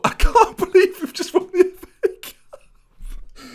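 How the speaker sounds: high, slow, giggling through it; laughing disbelief. Real, not acted. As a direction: laugh helplessly at the win.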